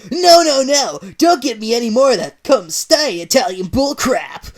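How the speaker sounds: loud, high angry snarl; snarling Sonic. Acted. Sonic rejects Mario's Italian, loud snarl. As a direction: snap it back at him. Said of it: "retorted with a snarl".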